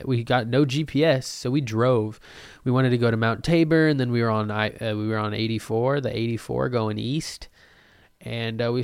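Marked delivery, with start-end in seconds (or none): none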